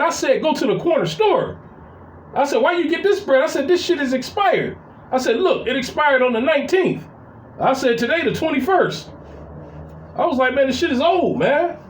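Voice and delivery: high, fed-up complaints; exasperated scolding. Retold or imitated. Brother scolding sibling over stale bread, high exasperated rant.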